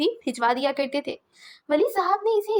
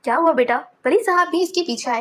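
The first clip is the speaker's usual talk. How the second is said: ordinary delivery, nothing marked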